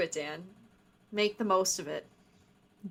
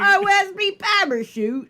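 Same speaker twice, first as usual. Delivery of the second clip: high, loud mock Cockney; bad British accent; 10 semitones higher, 7 dB louder than usual. Host apologising to England for a loud, high British accent. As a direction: butcher a London accent on purpose. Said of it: "I'm sorry, England".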